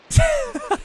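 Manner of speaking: very high real laugh; high squealing laugh. Real, not acted. Streamer still amused by own Walmart joke, high laugh.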